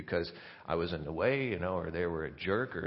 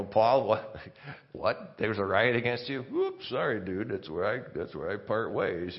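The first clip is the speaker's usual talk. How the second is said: flippant deserter; 4 dB louder than usual. Retold or imitated. Voicing a disloyal friend deserting Paul.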